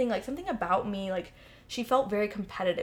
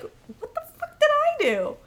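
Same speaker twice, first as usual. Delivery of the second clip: much higher, slow, indignant; exasperated outburst; 7 dB louder than usual. Retold or imitated. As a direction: cry out in disbelief.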